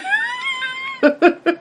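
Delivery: very high-pitched cackle; squealing laugh. Real, not acted. Cracking up at the punchline.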